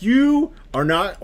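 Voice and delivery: high, loud, drawn out slowly; indignant disbelief. Retold or imitated. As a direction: stretch it out in outraged disbelief. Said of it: "I was like".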